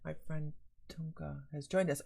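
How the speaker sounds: normal talking voice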